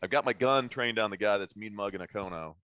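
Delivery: ordinary talk, nothing marked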